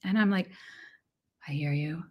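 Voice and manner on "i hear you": soft whisper to her own mind; hushed whisper to the inner critic. Retold or imitated. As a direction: breathe it out, barely voiced. Calmly answering her inner critic.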